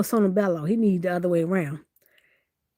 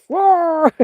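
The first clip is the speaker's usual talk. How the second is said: playful higher, loud fake roar; mock bear roar; 7 semitones higher, 6 dB louder than usual. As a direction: roar like a toy bear showing off. Bear voice growls.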